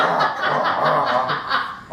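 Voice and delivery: loud, acted gasping "ah"s; hammed-up stuttering yelps of fright; mock panicked cries. Storyteller shows how he fled the bear, mock panic.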